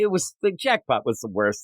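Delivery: ordinary talk, nothing marked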